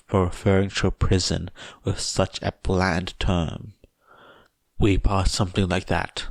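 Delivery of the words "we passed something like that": plain reading voice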